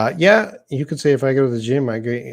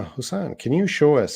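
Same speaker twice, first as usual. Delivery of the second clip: ordinary talk, nothing marked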